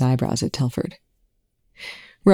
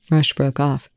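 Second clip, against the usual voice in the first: usual speaking voice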